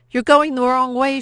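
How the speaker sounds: high, loud desperate scream. Acted. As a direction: yell it at the soldiers. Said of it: "she screamed".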